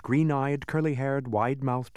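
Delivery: ordinary delivery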